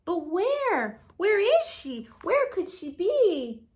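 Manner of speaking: searching, a touch anxious; baby bird wondering. Acted. Bird wonders where mother is.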